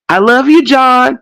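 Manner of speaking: high, sugary family goodnight; Waltons family goodnight. Retold or imitated. Mocking The Waltons family with a high sugary goodnight. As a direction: coo it like a sweet TV family. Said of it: "And in that movie".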